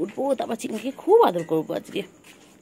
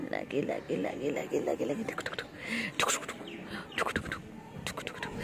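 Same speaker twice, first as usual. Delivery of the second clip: soft murmuring; 5 semitones lower, 11 dB quieter than usual. Real, not acted. Soft murmured pet noises.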